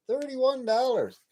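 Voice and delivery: stunned price reveal; shocked at the price. Real, not acted. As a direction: drag it out high in disbelief. Shocked at paper towel price.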